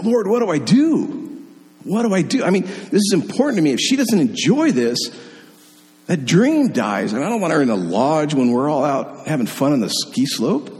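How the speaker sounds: high, pleading cry to God; a retold desperate prayer, pitched high; panicked prayer. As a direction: beg the Lord for help, half panicked. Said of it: "I'm praying. I'm like".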